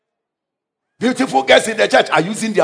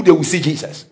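normal talking voice

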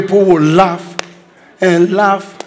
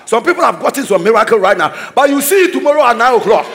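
preaching at full force; 8 semitones higher than usual. Acted. Preacher shouting blessings over the congregation. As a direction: proclaim it to the whole church.

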